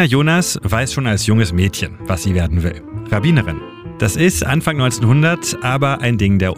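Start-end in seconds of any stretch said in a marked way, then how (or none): none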